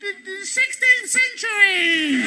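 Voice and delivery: high, slow exasperated shout; mimicking the actress. Actress repeating herself, high mock shout. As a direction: insist shrilly on the century.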